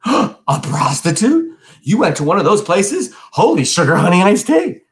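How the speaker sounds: gasp, then high, loud astonishment; scandalised shock. A narrator's reading. Brother learning where money went, scandalised shock. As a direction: gasp and squeal it, utterly scandalised.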